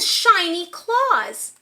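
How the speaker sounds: ordinary delivery, nothing marked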